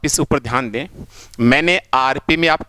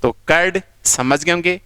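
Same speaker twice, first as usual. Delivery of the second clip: ordinary talk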